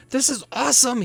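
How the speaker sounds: put-on eight-year-old boy. Game master voicing a young boy receiving a gift, high excited child voice. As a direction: gush like a thrilled kid. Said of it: "eight-year-old boy".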